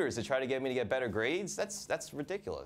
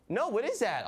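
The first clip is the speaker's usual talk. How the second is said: tutor voice; 11 semitones higher, 4 dB louder than usual. Retold or imitated. Tutor reacting.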